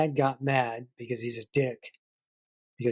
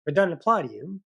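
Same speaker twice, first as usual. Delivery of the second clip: ordinary delivery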